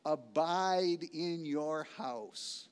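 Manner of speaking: abide in your house drawn out slowly; slow weighty quote; slow, deliberate. Acted. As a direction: lay each word down slowly. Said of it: "here it is".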